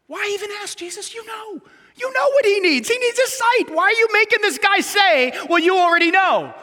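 high, loud, mock-exasperated protest to Jesus; exasperated exclamation. Real, not acted. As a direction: throw up your hands and protest.

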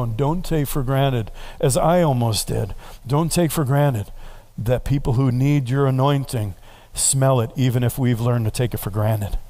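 ordinary talk, nothing marked

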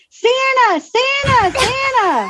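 put-on high-pitched, drawn-out call of an excited girl; imitated excited calling. Girl spotting Santa across the parking lot, imitated high calling. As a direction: mimic a girl calling out from afar.

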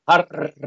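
"hard" with a long trilled R; rolled R. Shown as a demonstration. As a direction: roll the R in "hard" at length, showing off. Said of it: "when you roll your R's".

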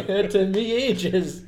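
slow, loud words through a real laugh; helpless laughter stretching the words. Real, not acted. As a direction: admit it while cracking up. Laughing at own slow realisation.

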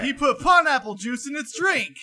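high, incredulous shout; loud quoting. Acted. Podcast guest belting out a movie quote, shouted. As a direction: yell it out in exasperated disbelief.

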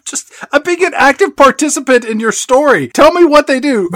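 high, loud playful protest; mock protest. Cohost pleads to hear the story, high and insistent. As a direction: push back in a whiny pleading voice.

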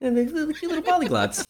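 put-on high cutesy baby-talk voice; mock cooing. Cohost picturing baby aliens, mock cooing. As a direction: coo over tiny cute creatures in a very high pitch.

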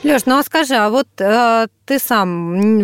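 ordinary talk

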